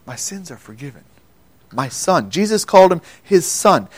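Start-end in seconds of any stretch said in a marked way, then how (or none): 0.1-2.3 hushed awe. Acted. Man hears Jesus's forgiveness.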